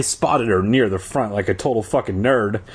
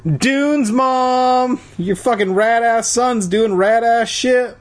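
high, sustained sing-out voice; hammed-up high-pitched heckle; mock tattling kid; 11 semitones higher, 3 dB louder, slower than usual. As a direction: call out to Mom in a high mock voice. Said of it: "they'd be like".

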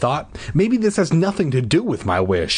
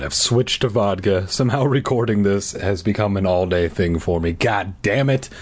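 ordinary delivery, nothing marked

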